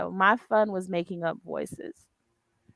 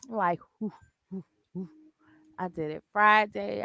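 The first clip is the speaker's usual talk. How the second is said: ordinary talk, nothing marked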